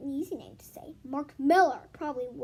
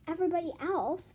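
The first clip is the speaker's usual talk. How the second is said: plain reading voice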